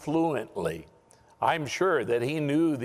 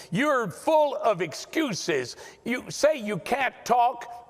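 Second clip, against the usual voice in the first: angry God; 7 semitones higher than usual. Retold or imitated. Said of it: "angry with him".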